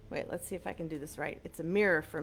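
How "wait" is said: ordinary talk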